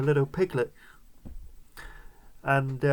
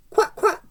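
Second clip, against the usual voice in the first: very high quack quack; duck quacks. A narrator's reading. The duck joining the farmyard uproar, high quacking. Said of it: "go the duck".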